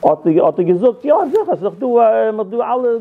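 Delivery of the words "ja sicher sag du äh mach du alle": high-pitched, loud retold royal reply; quick to agree, voice jumping up; eager assent. King answers the request for a prophet, eagerly.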